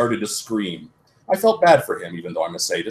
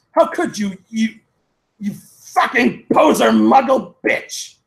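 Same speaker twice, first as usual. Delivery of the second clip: hammed-up furious insult; 12 semitones higher, 4 dB louder, slower than usual. A narrator's reading. Draco's outburst continues.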